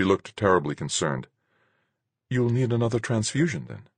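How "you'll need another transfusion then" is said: tag. Acted. Concerned Marguerite voiced in dialogue.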